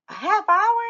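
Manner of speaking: loud, high-pitched outraged question; shocked disbelief. Acted. Red hearing how long the walk is, shocked.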